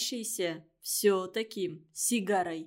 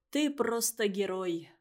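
ordinary delivery

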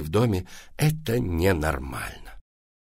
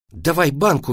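higher, brisk order; commanding; 7 semitones higher, 4 dB louder than usual. A narrator's reading. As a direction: mum demanding it firmly. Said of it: "велела мама".

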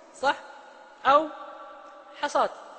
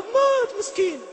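high, slow mock pity over the dead; pitying lament; 10 semitones higher, slower than usual. Preacher mimics people pitying the martyr, high slow lament. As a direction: draw it out like someone mourning a poor soul. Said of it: "بتروح تقول".

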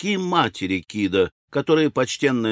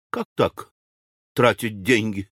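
puzzled widow's question; озадаченно. A narrator's reading. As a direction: ask it in bafflement. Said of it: "озадаченно спросила вдова". (озадаченный вопрос вдовы)

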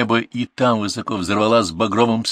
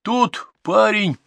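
very high, stretched-out cry; blurted outburst; 14 semitones higher, much slower than usual. A narrator's reading. Tipsy godfather announces himself to the raiders, crying out high.

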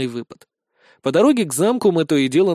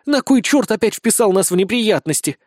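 high, annoyed demand; angry growl; 7 semitones higher, 4 dB louder than usual. Acted. Blonde blames the sergeant for trouble, angrily. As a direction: demand an answer in irritation. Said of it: "прорычала Безос".

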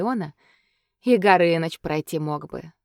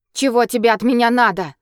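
louder, higher, shaking with fury; trembling with rage; 5 semitones higher, 7 dB louder than usual. A narrator's reading. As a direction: spit it out through rage. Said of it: "дрожащим от ярости голосом".

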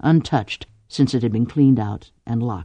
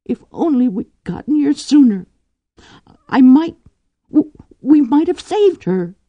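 tearful; 12 semitones higher, 6 dB louder than usual. Acted. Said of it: "who was crying quite silently".

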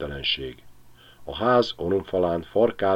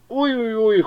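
a hurt, angry dog's yowl, far higher; offended dog howl; 3 dB louder than usual. A narrator's reading. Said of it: "üvöltött Vahur". (sértett, dühös kutyaüvöltés)

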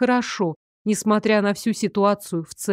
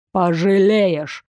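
drawn-out warning, louder; slow menacing threat; 3 dB louder, much slower than usual. Acted. Teacher warns the stubborn student, slow and menacing. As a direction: promise she'll regret it.

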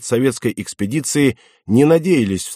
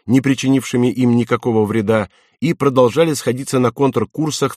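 ordinary delivery

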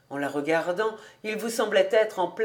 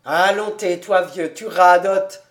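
louder, mock-scolding man; hearty scoff; scoffing dismissal; 3 semitones lower, 7 dB louder than usual. A narrator's reading. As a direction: brush him off with a raised voice. Kobus tells rabbi to shut up.

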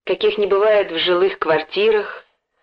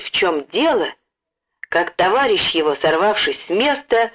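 ordinary delivery, nothing marked

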